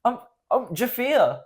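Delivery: play-acting an offended Australian, voice up high; mock Australian accent. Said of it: "Respect my Australian accent".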